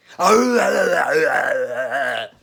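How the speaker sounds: long, loud monster roar; hammed-up monster howl. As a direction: belt out the beast's growl. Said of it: "it sounded like a big monster".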